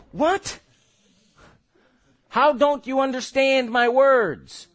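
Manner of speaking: high, incredulous, slowed exasperation; a parent baffled at the child, disbelieving; exasperated parent. Retold or imitated. Preacher mimicking a baffled parent, mock exasperated.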